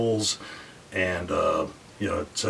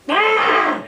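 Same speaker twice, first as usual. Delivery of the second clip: mock elephant scream; 9 dB louder than usual.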